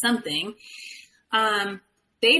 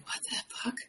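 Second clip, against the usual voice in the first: shocked whisper; 13 dB quieter, faster than usual. Retold or imitated. Frozen in shock at his words.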